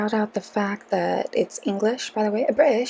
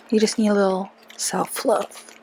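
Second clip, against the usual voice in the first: usual speaking voice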